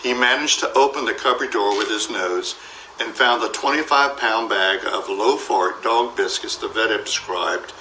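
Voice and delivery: usual speaking voice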